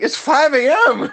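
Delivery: high, loud, slow, cracking into laughter; laughing disbelief. Retold or imitated. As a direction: push it up and out while losing it to laughter. Mike's outraged punchline quote.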